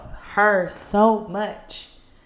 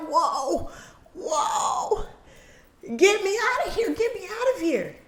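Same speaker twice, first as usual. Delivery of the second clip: put-on freaking out kid; 9 semitones higher than usual. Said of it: "start freaking out like".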